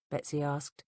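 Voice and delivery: usual speaking voice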